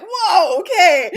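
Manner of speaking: loud, high startled whoa. Retold or imitated. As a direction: jump at a baby's scream. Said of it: "And I'm like".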